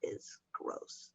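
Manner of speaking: breathy whispered aside. Real, not acted. Whispering to a friend about gross clients, hushed. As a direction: drop it to a whisper, almost under the breath.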